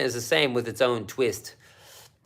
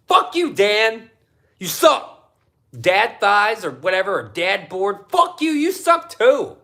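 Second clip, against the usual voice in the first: mock angry rant; 5 semitones higher, 5 dB louder than usual. Real, not acted. Ranting at skaters.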